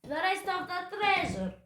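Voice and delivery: loud, high, impatient bellow; exasperated captain's outburst; pirate roar. A narrator's reading. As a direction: roar it at the captives. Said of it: "roared Captain Bones".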